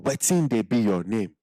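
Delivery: Nigerian Pidgin "Wetin dey be your name?" said clearly; Pidgin accent. Shown as a demonstration. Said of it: "have the accent right".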